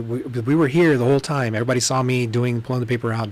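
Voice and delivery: usual speaking voice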